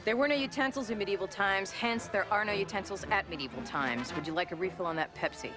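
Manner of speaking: high, snippy, bored waitress voice; snotty waitress. Acted. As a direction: brush him off, snottily.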